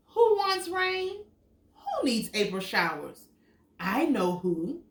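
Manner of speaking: lively storybook read, big high questions; animated picture book reading; reading to children. Acted. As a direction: read it out to little kids with wonder. Reads picture book aloud.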